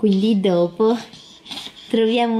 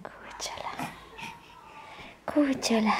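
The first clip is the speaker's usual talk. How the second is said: whispering; 11 dB quieter than usual. Real, not acted. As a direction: breathe the pet name. Whispering to baby.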